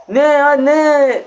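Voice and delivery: slow, high, stretched disbelief; surprised. Real, not acted. Kostüme şaşırıyor. (şaşkınlıkla uzatarak)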